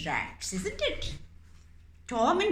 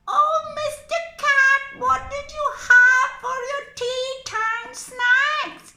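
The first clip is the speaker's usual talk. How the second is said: much higher, loud singsong question; singsong squeaky mouse; 9 dB louder than usual. Acted. Mouse puppet sweetly stalling the cat, singsong squeak.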